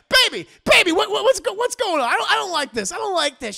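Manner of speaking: high, loud pleading, easing down; mock panicked husband. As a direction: plead in hammed-up alarm. Husband panics at rough play.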